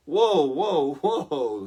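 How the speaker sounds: lightly voiced lion, calming her down; a hammed-up easy-there whoa; mock startled whoa. Mountain lion soothing the frightened girl, mock startled. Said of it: "says the mountain lion".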